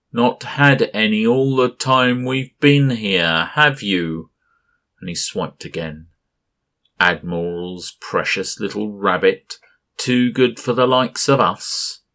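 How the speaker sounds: high, loud taunting monster voice; giant mocking voice. A narrator's reading. Gas giant Berenson mocks the captain, loud and taunting. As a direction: taunt like a huge cloud creature.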